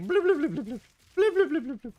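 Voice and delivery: high bubbly bloop noises; mock gibberish. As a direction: burble like a fish. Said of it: "the language is like".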